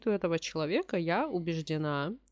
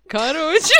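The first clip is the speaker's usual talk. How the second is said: very high, loud cackling laugh; hyena laugh; 15 dB louder than usual. Real, not acted. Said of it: "мой гиены смех тоже в целом вошёл в чат".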